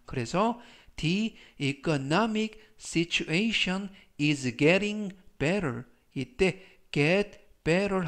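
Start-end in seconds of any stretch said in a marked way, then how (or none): 1.0-5.8 very slow, careful English reading; slow, clear teacher's demo of an English sentence; slow model sentence. Shown as a demonstration. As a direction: read it out word by word for learners. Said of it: "apostrophe S는 is의 줄임말입니다".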